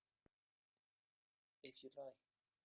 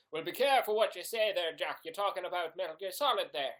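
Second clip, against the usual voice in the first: hint of a stern Father, louder and higher; admonishing priest; 4 semitones higher, 8 dB louder than usual. Father cautions Jack about criticising Metal Gear Solid, mock-stern. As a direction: lightly play a priest giving a loud warning.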